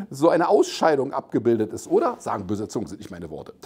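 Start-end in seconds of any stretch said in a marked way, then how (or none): 2.2-3.5 low, soft throwaway aside; muttered disclaimer. Real, not acted. Host disowning his own jibe, muttering low. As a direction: drop down and tuck it away quickly.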